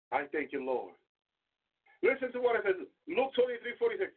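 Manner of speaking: usual speaking voice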